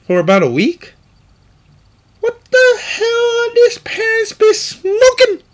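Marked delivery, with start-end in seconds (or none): 2.2-5.4 shrieking much higher, loud and slow; outraged shout. Real, not acted. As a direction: scream out the disbelief. Outraged rant about parents.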